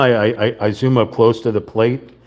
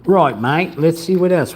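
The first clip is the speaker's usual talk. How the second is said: mock British accent; 8 semitones higher than usual. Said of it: "I start talking like this".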